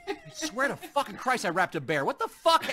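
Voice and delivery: loud, high mock outrage; imitated exasperation. [name] baffled by the present she wrapped, mock-exasperated. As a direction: act out baffled disbelief, building to an outburst.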